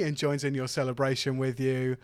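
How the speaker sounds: ordinary delivery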